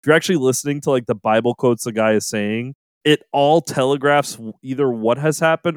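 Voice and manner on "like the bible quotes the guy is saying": ordinary talk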